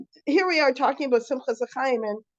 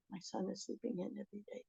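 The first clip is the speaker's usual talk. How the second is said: hushed confiding; 4 semitones lower, 21 dB quieter than usual. Retold or imitated. Confiding to the Rebbe.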